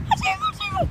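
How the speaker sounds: very high squeaky tickle voice; mock squeal; playful squeak. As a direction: tease like a tickle monster.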